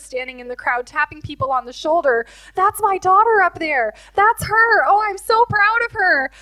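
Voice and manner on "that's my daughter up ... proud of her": high, loud, beaming pride; proud boasting. Retold or imitated. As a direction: show her off to everyone. Jesus boasting in the crowd.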